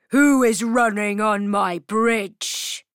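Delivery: booming troll challenge. Acted. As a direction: challenge the goat like a bridge troll. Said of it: "the troll says to the goat".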